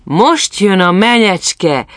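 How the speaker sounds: high, loud, drawn-out call; ringing festive cry; shouted announcement. A narrator's reading. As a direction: herald her arrival slowly to the crowd. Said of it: "kiáltották".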